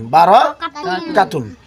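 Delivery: বারাকাতুন stretched out slowly, each syllable ba-ra-ka-tun spoken clearly; careful reading demo. Shown as a demonstration. Teacher reading the finished word aloud slowly for the students. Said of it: "তাহলে এটা কি হয়ে গেল?".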